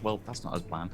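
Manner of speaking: quiet mutter under the breath. Acted. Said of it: "he will mutter under his breath".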